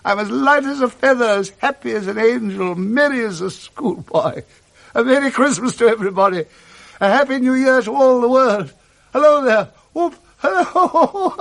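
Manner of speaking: put-on high, bubbling overjoyed old man; a miser suddenly light as a feather; giddy old Scrooge. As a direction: Scrooge beside himself with joy on Christmas morning. Scrooge overjoyed on Christmas morning.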